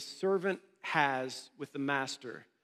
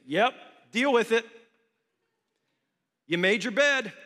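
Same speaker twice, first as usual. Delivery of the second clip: mock dismissive retort; 5 semitones higher, 5 dB louder than usual.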